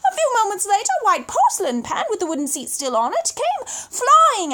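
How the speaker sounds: thrilling storyteller burst; excited crash narration. A narrator's reading. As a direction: tell it like it's happening right now. Furniture flying out windows.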